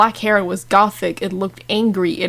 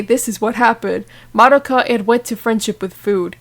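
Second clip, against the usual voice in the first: usual speaking voice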